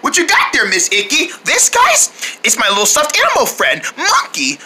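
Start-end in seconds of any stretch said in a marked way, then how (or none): none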